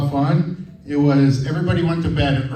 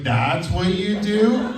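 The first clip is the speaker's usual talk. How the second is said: mock disbelief; 7 semitones higher, much slower than usual. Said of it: "And I was like".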